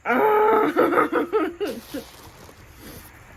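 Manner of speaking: high, loud burst of delighted laughter. Real, not acted. Laughing with joy at the friend's gift. Seeing the book sleeve.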